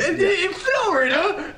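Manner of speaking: high, drawn-out mock British squeal; Paige impression. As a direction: squeal it in a terrible British accent. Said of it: "her sentence ends with".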